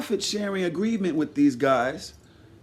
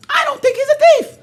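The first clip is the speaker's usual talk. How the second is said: much higher, loud insistence on thief; high emphatic; 7 dB louder than usual. Real, not acted. Judging Joe, high emphatic insistence. Repeated claim, pitched up.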